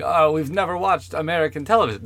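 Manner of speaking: imitating a Swedish English speaker; Swedish accent. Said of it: "This is a Swedish accent".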